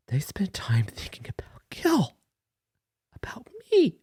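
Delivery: broken whisper, voice cracking up high; tearful whisper. Real, not acted. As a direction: whisper through tears. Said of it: "get a little emotional".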